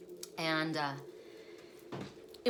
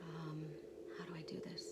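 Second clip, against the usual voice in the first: softer, breathy whisper to herself; 2 semitones lower, 14 dB quieter than usual. Real, not acted. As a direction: mutter it under her breath while fixing the upload. Fumbling with the video upload.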